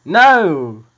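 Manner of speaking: sharp, loud rejection. Real, not acted. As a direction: shout it down hard. Host rejects wrong guess loudly.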